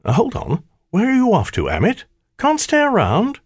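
high-pitched taunt after a fugitive; mocking call. A narrator's reading. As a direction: call after him with mock innocence. Calls after the fleeing shadow.

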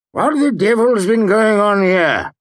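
high, loud blustering shout; outraged demand. A narrator's reading. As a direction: storm in demanding answers. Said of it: "he demanded".

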